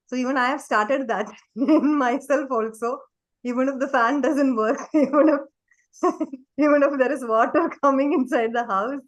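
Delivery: amused, talking through little bursts of laughter; laughing while talking. Real, not acted. Amused speaker laughs between phrases.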